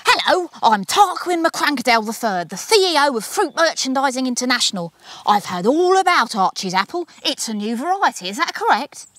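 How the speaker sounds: loud, high booming tycoon on the phone; booming businessman. A narrator's reading. CEO booms at Archie's daddy down the phone, loud and high. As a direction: boom down the phone line like a slick boss. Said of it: "boomed at him down the phone line".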